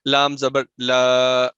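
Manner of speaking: لا drawn out slowly into a long alif; over-stretched madd. Shown as a demonstration. Teacher showing wrong lengthening, slow drawn-out لا. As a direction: stretch the vowel of لا far too long. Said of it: "دیکھیں الف آ گیا".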